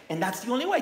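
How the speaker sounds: ordinary talk, nothing marked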